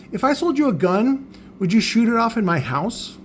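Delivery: high-pitched rhetorical challenge; indignant comeback. Retold or imitated. Retold comeback to customers.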